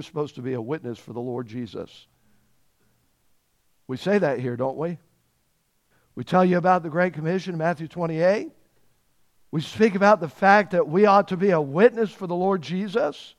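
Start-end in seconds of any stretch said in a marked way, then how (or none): none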